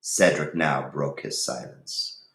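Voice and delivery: usual speaking voice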